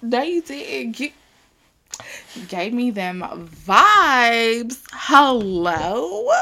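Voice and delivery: hammed-up girl what, no you didn't; mock outraged disbelief. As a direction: act scandalized by how good it is, laughing. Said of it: "I'm just like".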